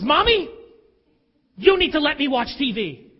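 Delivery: put-on high, loud bossy little girl; a daughter demanding TV from her mom; demanding child. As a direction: order mommy around like a child.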